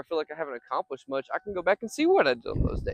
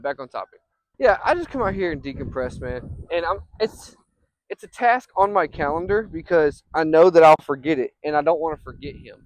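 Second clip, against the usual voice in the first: normal talking voice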